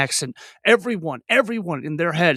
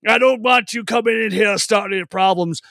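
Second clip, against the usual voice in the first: gruff bar owner; 4 semitones higher than usual. Acted. Owner ordering waitress, then warning sheriff, bossy voice. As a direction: play the bossy owner laying down the law. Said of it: "if I have him talking to her".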